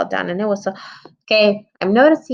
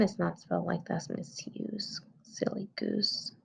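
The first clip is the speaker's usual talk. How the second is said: whispered aside; 17 dB quieter than usual. Real, not acted. Teacher teasing herself over a misspelling, whispered.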